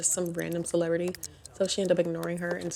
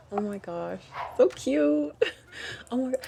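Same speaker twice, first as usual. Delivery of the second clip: gushing; 7 semitones higher than usual. Real, not acted. Gushing over baby walking.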